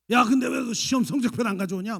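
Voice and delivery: loud, high demanding rebuke; villain dad. Retold or imitated. Father confronting child over report card, loud and harsh. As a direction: bark at the kid about his report card. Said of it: "아빠가 그 아이들의 마음속에 빌런".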